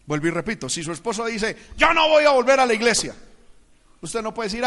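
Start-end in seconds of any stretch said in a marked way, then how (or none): 1.8-3.2 loud, quick acted refusal; defiant refusal. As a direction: put your foot down as a defiant husband. Acted husband refuses to attend church.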